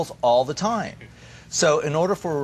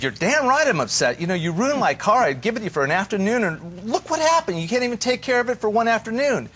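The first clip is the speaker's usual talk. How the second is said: higher, louder mock yelling, rising as it goes; hammed-up furious husband winding himself up; retold angry yelling; 10 semitones higher, 3 dB louder than usual. Husband berating his wife over the scratched car, mock yelling, higher and louder. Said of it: "yelling at her".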